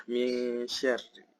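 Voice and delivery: "Min" hummed nasally into "syarri"; slow "Min syarri" with blurred n; samar, veiled n. Shown as a demonstration. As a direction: hold the n of "Min" hidden, like a thin ng. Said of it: "Jadi bacanya samar ya".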